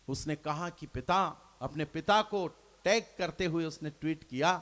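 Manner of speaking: ordinary talk, nothing marked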